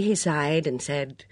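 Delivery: ordinary delivery, nothing marked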